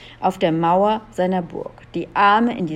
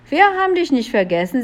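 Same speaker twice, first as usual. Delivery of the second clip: quick, higher reply; cheeky retort; 6 semitones higher, much faster than usual. A narrator's reading. Julia answers the invisible villain, cheeky. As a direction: toss it back fast in a raised pitch.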